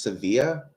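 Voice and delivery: "Sevilla" said se-VEE-uh, no L sound; silent double L. Real, not acted. Streamer guessing a viewer's name, "Sevilla" as suh-VEE-uh. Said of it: "I think that's pronounced".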